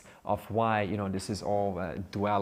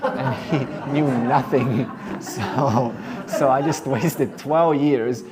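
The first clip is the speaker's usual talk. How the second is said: louder, slow, laughing through words; 4 semitones higher, 10 dB louder, slower than usual. Acted. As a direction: laugh at his own wasted years. Sadhu laughs at himself.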